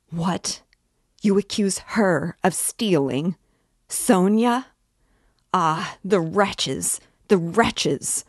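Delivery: mother figure exploding in indignation; outraged fury. Acted. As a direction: rage at the accusers. Katerina's outraged defence of Sonia.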